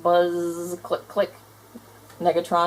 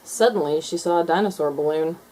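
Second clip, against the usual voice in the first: usual speaking voice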